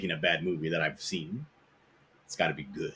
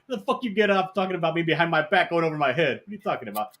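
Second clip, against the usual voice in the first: higher, hammed-up mobster rant; mock gangster yell; 8 semitones higher than usual. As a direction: play the angry movie mobster. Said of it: "immediately he's like".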